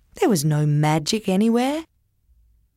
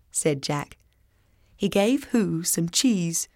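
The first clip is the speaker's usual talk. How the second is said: usual speaking voice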